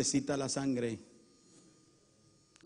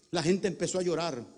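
normal talking voice

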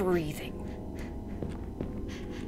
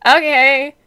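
panicked; 7 dB louder than usual. Real, not acted. Flashlight turns red suddenly.

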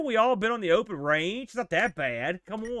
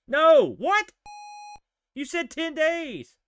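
outraged disbelief; 10 semitones higher, 4 dB louder, slower than usual. Real, not acted. Outraged at the game.

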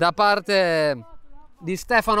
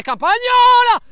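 high, loud sudden cry; excited shout; 8 dB louder, slower than usual. Real, not acted. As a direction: call out the name in alarm. Keeper makes a save.

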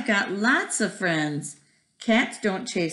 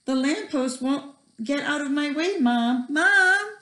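put-on whiny child; 8 semitones higher than usual. Splat whining to avoid school.

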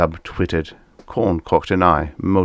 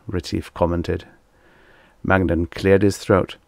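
ordinary delivery

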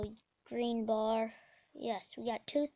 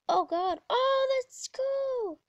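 much higher, louder burst; excited outburst; 12 semitones higher, 7 dB louder, slower than usual. Real, not acted. Gamer reacting to the action, high excited outburst. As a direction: squeal it in sudden excitement. Sudden reaction while playing.

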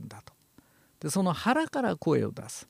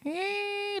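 very high falsetto cat cry, much softer; 7 dB quieter than usual. Shown as a demonstration. Teacher showing the cat voice, high falsetto. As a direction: squeeze out a thin falsetto meow. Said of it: "猫の声は高い裏声".

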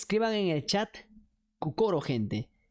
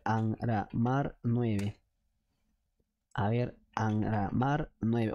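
slow dictation; 9 semitones lower, much slower than usual. Real, not acted. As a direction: stretch every syllable out very slowly. Dictating the name while typing. (dictado muy lento, sílaba por sílaba)

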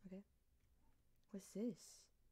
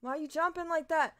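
ordinary talk, nothing marked

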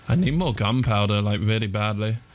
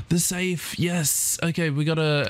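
excited; 5 semitones higher than usual. Real, not acted. Finds the safe.

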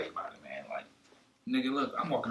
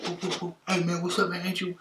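mock crackhead; 7 semitones higher than usual. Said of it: "A crackhead run up on me, like".

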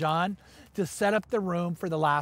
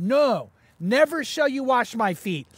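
Peter's refusal; 5 semitones higher, 5 dB louder, slower than usual. Retold or imitated. Scripture reading, Peter refusing.

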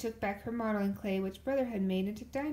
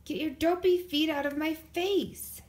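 loud, higher, slow angry yell; angry shout; 7 semitones higher, 5 dB louder than usual. A narrator's reading. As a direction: yell at her to move her feet. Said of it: "he shouted".

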